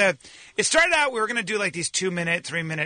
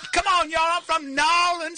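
bad Creole accent; 7 semitones higher than usual. Pitching New Orleans to laughing hosts, bad Creole accent. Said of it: "that is not a Creole accent".

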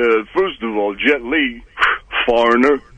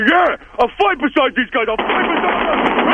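pumped-up fan; 14 semitones higher than usual. Retold or imitated. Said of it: "You say".

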